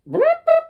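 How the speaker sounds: very high, loud lip buzz; lip trill trumpet. Shown as a demonstration. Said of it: "So if you can do brrr".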